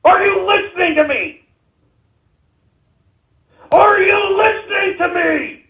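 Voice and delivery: furious shout. Real, not acted. Host yelling at viewers to take the country back, furious shout. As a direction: scream it at people who won't listen. Rant boils over into shouting.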